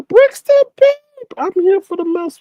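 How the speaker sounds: squealed high and loud, delighted; excited squeal. Real, not acted. Greeting a chatter in the stream, excited high squeal.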